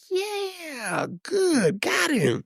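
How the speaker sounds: very high, soft, mocking glee; gloating rivals. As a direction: imitate staff secretly cheering his fall. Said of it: "that expression like".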